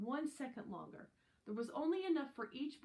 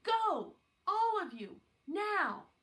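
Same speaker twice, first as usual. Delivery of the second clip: high, loud urgent order; urgent shout; 9 semitones higher, 8 dB louder, slower than usual. Acted. Teacher to the class, urgently shouting them out. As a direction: push them out the door with your voice.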